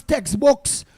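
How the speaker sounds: ordinary delivery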